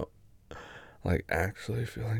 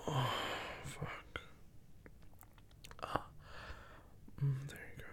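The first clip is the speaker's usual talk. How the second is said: very soft, breathy murmur; breathy whisper; 4 semitones higher, 18 dB quieter than usual. Acted. Breathless moment of pleasure.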